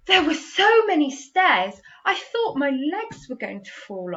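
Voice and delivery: high, loud exclamation; exclaiming. Shown as a demonstration. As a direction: burst it out like your legs gave up. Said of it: "Exclamation mark".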